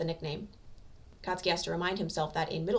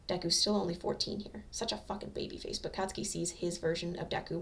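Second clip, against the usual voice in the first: usual speaking voice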